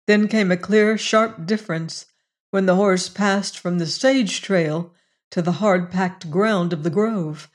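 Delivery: ordinary delivery, nothing marked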